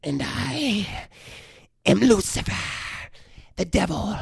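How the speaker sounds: eerie demon fading to a long hiss; possessed demon voice. Acted. Possessed girl revealing she is Lucifer, hissing demon voice. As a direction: draw it out, sinking into a hissed last word. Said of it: "she's changing voices throughout this".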